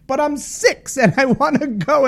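put-on high, slow childish whine; little girl whine. Girl begging her mom for the pub, high slow whine. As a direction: plead like a six-year-old. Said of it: "She's like".

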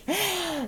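short, high, soft giggle; a quick self-amused laugh; light chuckle. Real, not acted. Host amused at her own gloomy title, light giggle.

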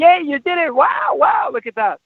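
put-on high, excited cheer for a toddler; happy parent cheering. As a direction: gush with delight at the child's feat. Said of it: "You do it automatically when your child's happy".

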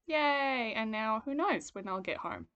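ordinary talk, nothing marked

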